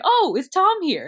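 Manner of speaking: high, loud, drawn-out hopeful surprise; light up and ask, voice lifting high; eager question. Retold or imitated. Soldier asking if his brother Tom is here, eager surprise.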